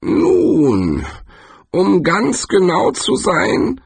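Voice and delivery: lightly voiced pedantic tree. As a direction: correct him slowly like a pedantic tree. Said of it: "begann der Baum".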